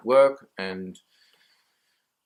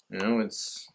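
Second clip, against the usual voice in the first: ordinary talk, nothing marked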